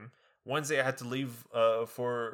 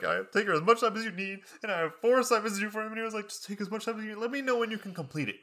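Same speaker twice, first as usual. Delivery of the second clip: higher, sweet-voiced kind professor; high mock voice; 10 semitones higher, faster than usual. Student gushing over kind econ professor in high voice. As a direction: sing it out like a saint.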